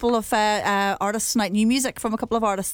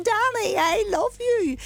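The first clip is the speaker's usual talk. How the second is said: mock fan shout; 12 semitones higher, slower than usual. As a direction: imitate a fan calling out to a singer. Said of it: "like, shouting, going".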